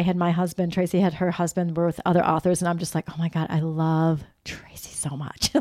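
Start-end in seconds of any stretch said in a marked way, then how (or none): 3.1-5.5 breathless whisper of adoration; a whispered fangirl gush. Retold or imitated. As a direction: whisper it like a secret crush. Gushing confession at dinner, retold.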